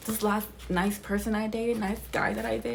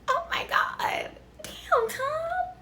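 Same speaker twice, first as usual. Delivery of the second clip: shocked cry; much slower than usual. Real, not acted. Shocked reaction to Tom's scheme.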